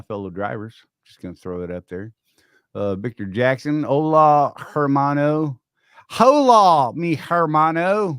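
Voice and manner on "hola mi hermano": loud, high call, last word drawn out; hammed-up greeting. Host playfully re-does a viewer's hello, big loud call. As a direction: belt out the Spanish greeting.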